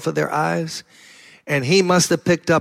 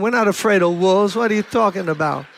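usual speaking voice

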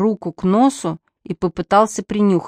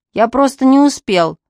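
higher, louder nasal reply from the fog; 7 semitones higher, 5 dB louder than usual. A narrator's reading. Lieutenant answers from the fog, nasal. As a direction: squeeze it through a blocked nose. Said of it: "гнусавый".

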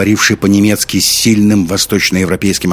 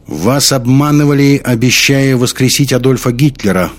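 loud, higher public speech; raised, persuasive declamation; convincing oratory; 7 semitones higher, 5 dB louder than usual. A narrator's reading. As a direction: address a whole hall with conviction. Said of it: "голос его звучал так убедительно".

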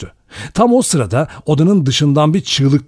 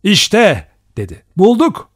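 cry of joy; 7 semitones higher than usual. A narrator's reading. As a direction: shout out the discovery. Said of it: "bir sevinç çığlığı attı". (sevinç çığlığı)

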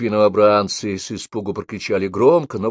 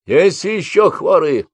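shouting drill sergeant; 9 semitones higher, 5 dB louder, slower than usual. A narrator's reading. Sergeant calls out to ranks.

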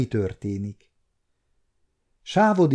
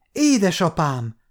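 high cry with a touch of tears; mildly acted tearful call; 4 semitones higher than usual. A narrator's reading. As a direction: call out to father, moved. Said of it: "kiáltotta sírva a kislány".

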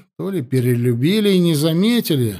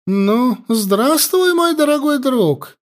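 much higher, louder sneering greeting; mocking; 10 semitones higher, 3 dB louder, slower than usual. A narrator's reading. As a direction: greet him with cruel mockery. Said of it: "Издевательски поздоровалась".

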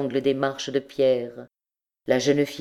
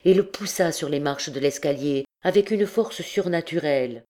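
ordinary delivery

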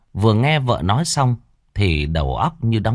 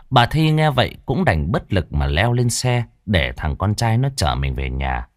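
plain reading voice

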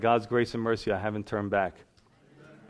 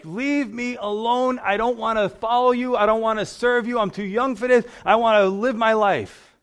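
retold scream; 8 semitones higher, 5 dB louder than usual. Backslider yelling at God in the street, pleading scream. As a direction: cry it out to the sky like a desperate fool. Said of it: "I screamed that from my heart".

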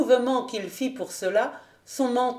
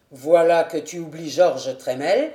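louder, bright smiling exclamation; exclaiming with a smile; 4 semitones lower, 6 dB louder than usual. A narrator's reading. Didier to the servant, exclaiming with a smile. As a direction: call it out with a grin. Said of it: "s'écria Didier en souriant".

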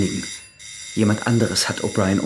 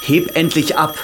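urgent call; 6 semitones higher, 4 dB louder, slower than usual. Acted. Urging the phone to be answered.

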